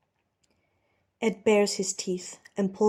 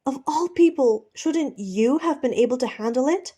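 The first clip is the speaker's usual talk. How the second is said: higher, louder accusation; exasperated shout; 6 semitones higher, 6 dB louder than usual. Acted. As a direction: throw it at him angrily. Steed yelling in exasperation.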